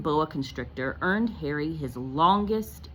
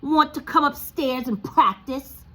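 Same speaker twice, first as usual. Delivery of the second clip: higher, louder bullying taunt; sneering taunt, much higher and louder; Dudley taunting; 9 semitones higher, 7 dB louder than usual. Acted. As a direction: jeer at him like the school bully. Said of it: "he told Harry".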